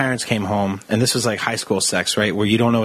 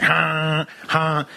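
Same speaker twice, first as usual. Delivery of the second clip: higher, held honking noises; imitated car horn; 4 semitones higher than usual. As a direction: mimic two blasts of a car horn. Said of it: "She starts honking her horn like crazy".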